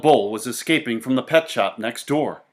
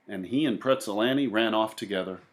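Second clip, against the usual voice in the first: ordinary delivery, nothing marked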